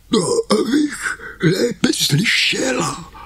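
very high, slow put-on old woman; wolf as granny. The disguised wolf answering Karkulka, high and slow. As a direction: sweeten it like a fake granny.

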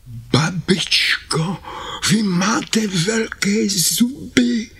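put-on very high, slow and breathy little girl; Karkulka's voice. Karkulka noticing the teeth, high and slow. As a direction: ask slowly, frightened.

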